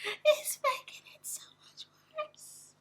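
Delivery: urgent cringing whisper. Real, not acted. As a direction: hiss it in secondhand shame.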